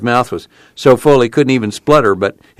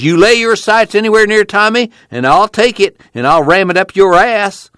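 high, hammed-up old man's threat; cackling backwoods bravado; threatening old Grandpa; 11 semitones higher than usual. A narrator's reading. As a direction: crow the threat like a cocky old codger. Grandpa retells his threat.

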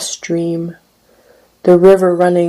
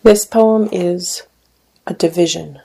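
normal talking voice

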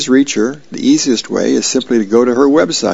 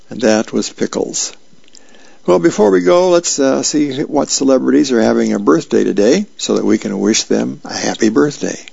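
normal talking voice

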